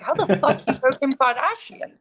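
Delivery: retold outburst of disbelief, pitched high; high, amused mock astonishment; incredulous. Daughter baffled by dad's celebrity reference, incredulous. Acted astonished reply to dad.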